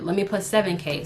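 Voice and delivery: ordinary delivery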